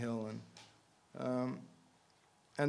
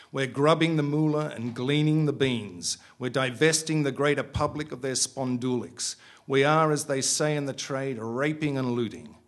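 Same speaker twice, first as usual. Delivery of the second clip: louder, boastful sales patter; loud manic bravado; 4 semitones higher, 9 dB louder than usual. Acted. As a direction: hype the boy up loudly. Bunny holds forth to son.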